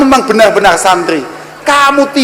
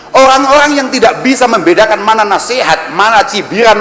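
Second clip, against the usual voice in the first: normal talking voice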